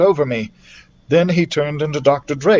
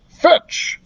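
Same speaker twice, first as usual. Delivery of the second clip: sharp command. Acted. Doctor Drake calls to Scorcher, sharp command. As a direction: call the command out to the dragon.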